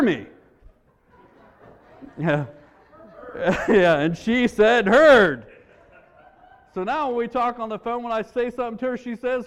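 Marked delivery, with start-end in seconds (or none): 4.9-5.5 called-out reply. Retold or imitated. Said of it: "he would yell out".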